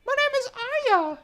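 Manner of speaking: very high-pitched put-on voice; mock character voice. Co-host playing the girl naming herself, mock character voice.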